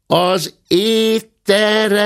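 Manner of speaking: high, slow, loud call; shouted. A narrator's reading. Maid shouting into the guest's ear, high and slow. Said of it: "kiáltotta a szobalány a bátya fülecskéjébe".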